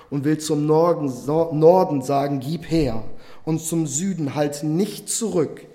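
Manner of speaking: full-voiced sermon reading of the prophet; preaching proclamation. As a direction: proclaim God's words to the congregation. Reads Isaiah building to proclaiming.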